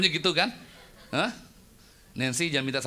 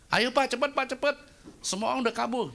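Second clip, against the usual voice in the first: high, hurried call from a rescue boat; urgent rescuer call; 11 semitones higher, faster than usual. Retold or imitated. Rescuer calling up to the man on the roof, high and urgent. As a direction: hurry the stranded man aboard. Helicopter crew calls out.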